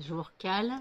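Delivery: ordinary talk, nothing marked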